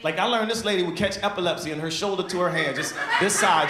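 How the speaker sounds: ordinary talk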